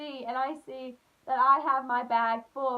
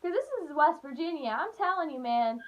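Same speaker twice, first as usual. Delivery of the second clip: normal talking voice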